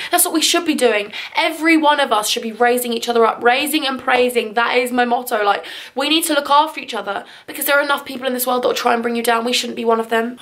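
ordinary talk

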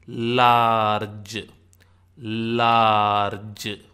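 "large" stretched loud and slow, laaar-j; demonstrated long vowel. Shown as a demonstration. Teacher shows la as in large, stretched "laaarge". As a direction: draw out the aa in "large". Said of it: "it is pronounced like L A in".

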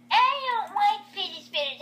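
mock protest.

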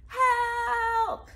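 long, high, loud holler; hollering for help. Acted. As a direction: call out for help across the room. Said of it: "Missus Folly hollered".

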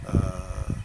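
usual speaking voice

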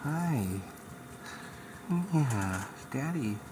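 slow, drawn-out loving hello. Real, not acted. Father cooing hello to his baby son, slow and tender. Father greets newborn in NICU.

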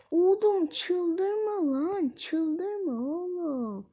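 slow, drawn-out mock begging; hammed-up begging with stretched endings; playful pleading. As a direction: plead in long, swooping vowels. Begging game boss not to rage.

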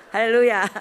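big joyful hallelujah; loud exultant hallelujah; shouted hallelujah. Real, not acted. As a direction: cry out in praise. Exclaiming praise.

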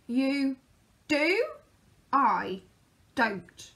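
spaced-out, one word at a time; slow reply. A narrator's reading. Mister Slow answering Mister Busy, slow. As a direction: let each word drag. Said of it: "said Mister Slow".

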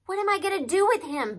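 high, loud complaint; exasperated. A narrator's reading. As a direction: complain helplessly about a kid brother. Exasperated brother complains to colonel.

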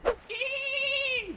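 high piercing dolphin squeak; dolphin squeal. Shown as a demonstration. Streamer showing chat what a dolphin sounds like, high squeal. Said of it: "That's, that's the sound a dolphin makes".

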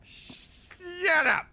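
sudden high yelp of fright; startled shout. Real, not acted. Scared streamer yells at cohost's jump scare.

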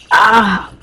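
short loud "ah"; pained cry. Pretend. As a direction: yelp at a game injury. Cries out at game injury.

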